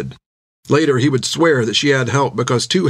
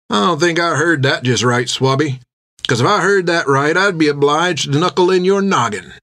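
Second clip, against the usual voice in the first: menacing sailor; 5 semitones higher than usual. Acted. Bill threatening Finn over the table, menacing. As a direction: warn the kid off with a smirk.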